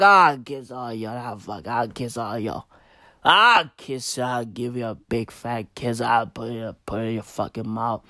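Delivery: put-on low, rambling drunk uncle voice. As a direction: slur it like a tipsy uncle at a party. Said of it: "sounds like everybody's drunk uncle".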